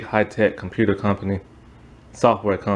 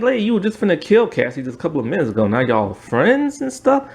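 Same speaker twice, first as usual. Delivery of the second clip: exasperated; 9 semitones higher than usual. Retold or imitated. Viewer ranting at the show's twist.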